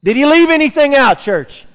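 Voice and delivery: loud, high, rousing sermon reading of scripture; pulpit-pounding proclamation; fiery preaching. Acted. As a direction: preach it out to the church with full force. Preaching Romans eight aloud.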